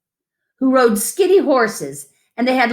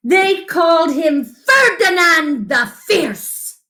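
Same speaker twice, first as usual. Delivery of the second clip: dramatic announcement; 6 semitones higher than usual. A narrator's reading. Telling a child the bull's fearsome name, dramatically.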